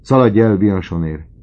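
loud, sudden call to the servant; frightened young man shouting; urgent shout. Acted. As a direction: shout the order out in alarm. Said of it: "Kiáltott Özsen".

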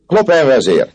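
high, loud call to the leader. Acted. André calls out to chief Clopin, loud high hail. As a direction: hail the chief from across the street. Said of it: "kiáltott fel André Le Rouge".

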